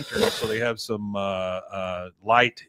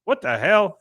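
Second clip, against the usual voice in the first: shocked; 8 semitones higher, 8 dB louder than usual. Retold or imitated. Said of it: "He looks at it and goes".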